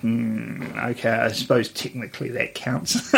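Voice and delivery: low, quiet, reluctant acceptance; grudging concession. Retold or imitated. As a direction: mutter it as a doubtful concession. Retells own grudging reaction to map.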